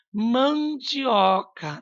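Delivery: "Mandioca" very slow, long nasal "man"; slow dictation of "Mandioca", leaning on "man"; nasal syllable stressed. Shown as a demonstration. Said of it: "Esse an é com N".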